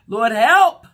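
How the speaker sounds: sudden loud plea on help; desperate cry of Lord help. Shown as a demonstration. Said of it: "open up your mouth and say".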